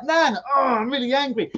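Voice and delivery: mock tantrum in a kid's voice; child's tantrum. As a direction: stamp it out like a cross little kid.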